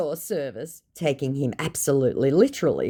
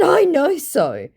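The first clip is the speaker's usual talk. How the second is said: smug retort; 13 semitones higher, 5 dB louder, slower than usual. Retold or imitated. Her confident retort.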